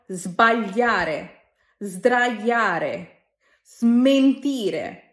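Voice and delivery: sbagliare, sdraiare, smentire each starting with a buzzing z; slow careful model of sbagliare, sdraiare, smentire with z; z before consonants. Shown as a demonstration. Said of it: "তখনও সেটাকে জ করে উচ্চারণ করতে হয়".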